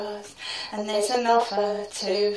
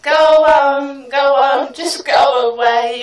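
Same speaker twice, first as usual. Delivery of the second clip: loud, fed-up shout; yelled at the man outside the changing room; shooing him off; 3 semitones higher, 6 dB louder than usual. Acted.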